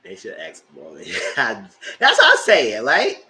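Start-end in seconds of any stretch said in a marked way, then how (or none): 1.4-3.2 loud, slow laugh-through agreement; laughing yes. Real, not acted.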